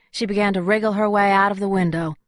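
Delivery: ordinary delivery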